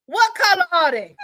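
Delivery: high shouted call from offstage; from another room. Retold or imitated. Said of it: "here go to Danielle from the other room".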